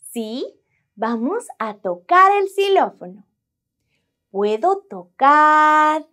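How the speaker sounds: usual speaking voice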